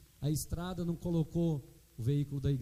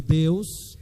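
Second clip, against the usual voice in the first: slow, louder declaration for the church to repeat; louder, slow preacher's line to echo; call and response; 3 semitones lower, 7 dB louder, slower than usual. Shown as a demonstration. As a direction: proclaim it so they say it back. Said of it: "Fala comigo".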